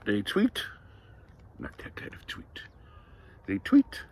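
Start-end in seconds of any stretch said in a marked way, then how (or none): 1.6-2.6 near-whispered, very soft correction; hushed aside. Shown as a demonstration. Correcting the kind of tweet.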